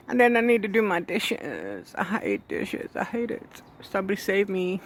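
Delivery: jokey melodrama about chores; mock despair. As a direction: playfully beg to be rescued from chores. Moaning about doing the dishes.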